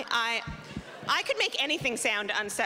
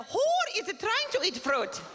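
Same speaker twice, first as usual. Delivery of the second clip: baffled; 10 semitones higher than usual. Retold or imitated. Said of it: "I'm like".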